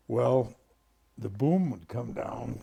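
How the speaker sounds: usual speaking voice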